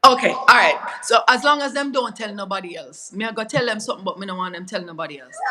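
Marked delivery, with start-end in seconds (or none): 1.0-5.4 casual Jamaican patois. Real, not acted. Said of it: "my Jamaican accent coming out".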